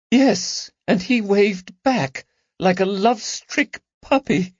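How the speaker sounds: high, trembling wounded voice; hurt holding back tears. Acted. Lady Caroline recalling his betrayal to friends, a touch trembling. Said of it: "voice trembled".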